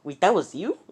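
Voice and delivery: high, loud disbelieving question; surprised realization. Retold or imitated. Deku realizing the blue screen was the system, surprised. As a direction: blurt it out as it dawns.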